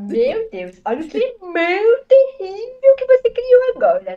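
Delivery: high, put-on comedian voice dropping at the end; hammed-up complaint in a comic character voice; Igor Guimarães. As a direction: do a mock-horrified comic impression. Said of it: "Aí eu falo".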